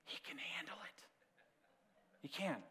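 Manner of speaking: leans in, drops to a whisper on the last words; secret to the congregation. Real, not acted. Pastor lets his church in on a secret, whispered. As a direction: share it like a secret. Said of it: "Lean in. Listen".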